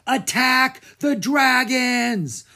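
"Attack the dragons!" yelled high, loud and slow; rally the mob with a furious yell; angry battle cry. Acted. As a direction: villagers charging with an angry cry. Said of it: "A loud, angry cry".

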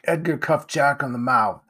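ordinary delivery, nothing marked

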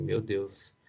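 soft, private exclamation to oneself; inner thought. Retold or imitated. Son alone in the car, thinking to himself, softly. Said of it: "fui no carro pensando assim".

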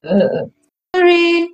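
jolted out of a doze; startled awake. Real, not acted. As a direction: snap awake, flustered. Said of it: "She slept again".

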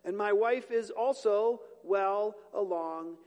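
put-on an old priest questioning the angel slowly; doubting old Zechariah. Zechariah pleading doubt to the angel, slow and loud. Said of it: "Zechariah said to the angel".

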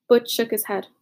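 usual speaking voice

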